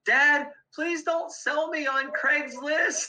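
put-on high-pitched, drawn-out child's plea; pleading daughter. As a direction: imitate a little girl wailing to dad. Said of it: "And she goes".

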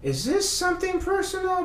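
high, suspicious whisper-like question; his paranoid brain wondering, high and suspicious; paranoid inner voice. Retold or imitated. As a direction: ask like a conspiracy theorist. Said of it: "conspiracy theory brain was like".

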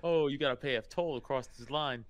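lightly hammed-up Jersey accent; a hint of a Jersey tough guy. Chimp gangster taunting a rival, light Jersey accent. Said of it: "They all adopt, uh, Jersey accents".

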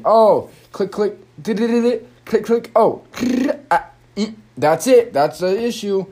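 high, bouncy voice with clicking noises; expert tinkering. Retold or imitated. Imagined tech helper clicking through settings, high breezy voice. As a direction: breeze through the fix like it's nothing. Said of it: "someone that knows what the fuck".

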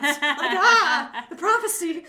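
loud, high laugh through the words; cracking up mid-joke. Real, not acted. Joking about a prophecy, laughing loudly.

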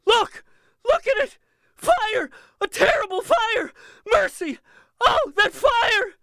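hysterical high-pitched screaming. A narrator's reading. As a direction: shriek in wild panic.

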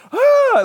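high, loud cry of exaggerated terror; mock frightened cry. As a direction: cower with a hammed-up shriek. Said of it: "like, cower in fear".

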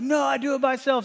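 put-on high, insistent toddler voice. Daughter refusing to be buckled, high insistent toddler voice. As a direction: pipe up like a defiant little girl. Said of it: "she's like".

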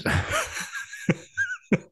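high laugh. Real, not acted. Laugh after the announcement.

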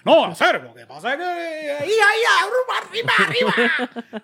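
rising to a very high, loud, laughing arriba; mock Cuban dub accent. Host imitating a dubbed movie, accent sliding into Mexican shout. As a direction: start Cuban and slide into cartoon Mexican. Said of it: "le ponen un acento cubano".

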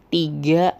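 normal talking voice

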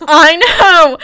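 real high, loud outburst; loud agreement. Real, not acted. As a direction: burst out in emphatic agreement. Laughing agreement that he's being roasted.

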